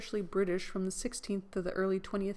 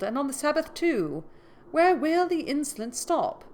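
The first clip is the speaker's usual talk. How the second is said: high, loud outrage; outraged old man; 9 semitones higher, 5 dB louder than usual. A narrator's reading. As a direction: rise in indignation. Magistrate outraged at intrusion.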